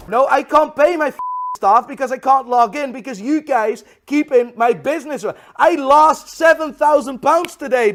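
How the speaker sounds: loud, high, furious complaint; exasperated high-pitched tirade; angry rant. Real, not acted. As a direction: yell it at the bank in outrage. Ranting at bank over lost money.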